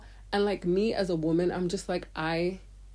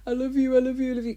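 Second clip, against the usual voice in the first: mock crying man; 7 semitones higher, 5 dB louder, faster than usual. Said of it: "crying, tears in his eyes".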